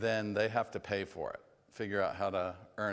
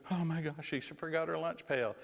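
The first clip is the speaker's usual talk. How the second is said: panicky rescuing parent; 7 semitones higher than usual. Retold or imitated. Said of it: "Rather than you".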